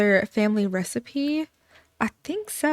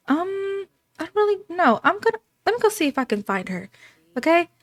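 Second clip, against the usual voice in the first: put-on higher, light young girl voice; girl's voice; 8 semitones higher, faster than usual. Young girl answering her aunt, higher and timid. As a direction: voice the child, a bit timid. Voicing girl in Sims story.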